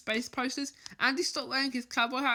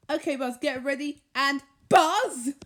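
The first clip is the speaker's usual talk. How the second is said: louder call of the name; 3 semitones higher, 8 dB louder, slower than usual. A narrator's reading. Woody calling Buzz as he runs off, loud. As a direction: shout after Buzz.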